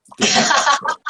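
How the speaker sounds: giggling punchline; laughing. Real, not acted. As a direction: crack up as you repeat it. Repeated line, laughing, as a punchline.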